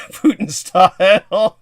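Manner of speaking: slow, laughing tease. Real, not acted. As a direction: laugh through the words. Teasing a caller, laughing.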